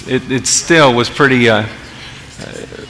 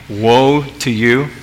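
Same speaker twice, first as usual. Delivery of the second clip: slow, gentle lilt; mild, soothing Jesus; slower than usual. Acted. Preacher asking congregation to picture a too-nice Jesus, slow and mild. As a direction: stretch the warning out peacefully. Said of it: "saying it in that kind of tone of voice".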